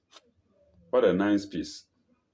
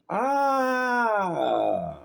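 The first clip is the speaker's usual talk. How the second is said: long high scream falling away; 11 semitones higher than usual. A narrator's reading. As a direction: cry out in a drawn-out, falling "ah". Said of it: "and screams".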